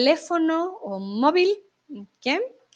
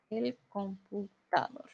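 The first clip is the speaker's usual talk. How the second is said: soft repeated model word; 4 semitones lower, 12 dB quieter, slower than usual. Shown as a demonstration.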